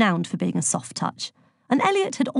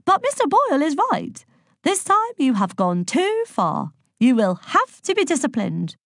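reluctant headmaster; 8 semitones higher than usual. A narrator's reading. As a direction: reluctantly lay down the law in a fussy high voice. Nervous head reluctantly tells off pupil.

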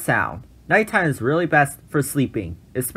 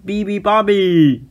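yelled; 7 semitones higher, 4 dB louder than usual. A narrator's reading. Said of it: "yelled the hippos".

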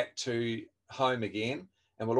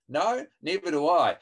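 smug answer; 6 semitones higher, 6 dB louder than usual. Shown as a demonstration. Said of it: "don't go".

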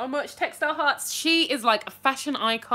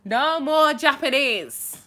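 slow, loud no more Japanese; pleading refusal; 4 semitones higher, 7 dB louder, slower than usual. Real, not acted. Streamer begging chat to stop writing Japanese, slow plea. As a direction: beg them to stop, half laughing. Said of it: "please, no, no".

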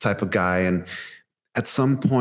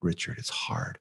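whispered, much softer, nearly voiceless; vulnerable whisper; 3 semitones lower, 9 dB quieter than usual. Retold or imitated. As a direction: confide it in a broken hush, name and all. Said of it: "when he just said that word".

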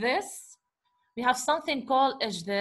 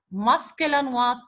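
normal talking voice

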